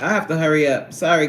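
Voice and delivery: normal talking voice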